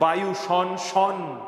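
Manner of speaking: বায়ু শন শন drawn out slowly, the শ hissing; reciting the rhyme very slowly; slow rhyme recital. Shown as a demonstration. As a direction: chant it like a nursery verse. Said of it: "একটা কবিতা পড়েছিলাম".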